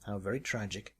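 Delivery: usual speaking voice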